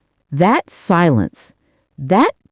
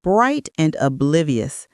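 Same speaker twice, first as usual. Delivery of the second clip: ordinary delivery, nothing marked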